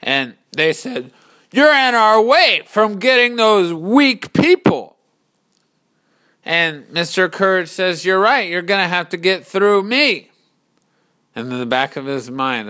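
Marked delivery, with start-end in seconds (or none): 1.5-5.0 loud, high shouted threat; threatening warriors. Acted. Army threatens the hero. 8.0-10.3 raised, loud challenge; defiant reply. Acted. Hero defies the army.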